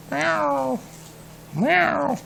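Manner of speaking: high mewing cat voice; cat meows. Acted. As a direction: meow like a small cat. Cats meowing in the church.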